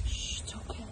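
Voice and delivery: whispered hush. Real, not acted. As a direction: hush her gently, unvoiced. Hushing the crying baby.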